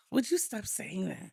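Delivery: hushed exasperated whisper. Real, not acted. Wife hushing her husband's joke in a whisper. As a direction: whisper the scolding.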